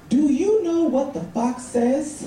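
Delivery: acted little niece. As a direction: ask it slowly, like a kid quizzing an adult.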